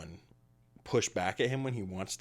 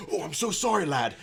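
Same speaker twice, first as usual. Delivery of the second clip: much higher and louder, flustered; a big creature caught in a clumsy moment; apologetic minotaur; 8 semitones higher, 6 dB louder than usual. Acted. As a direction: minotaur apologizing for lifting the traveler, high and flustered. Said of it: "he goes".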